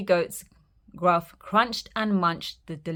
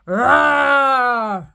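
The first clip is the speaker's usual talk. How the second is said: loud stomping hoof sounds; loud big goat thundering onto the bridge; big heavy stomp; 5 semitones higher, 11 dB louder, much slower than usual. Acted. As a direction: stomp it out heavily. Big goat stomping, troll roars.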